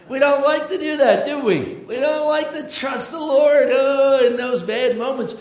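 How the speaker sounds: high, loud preaching voice. Acted. Pastor to the congregation, preaching with full force.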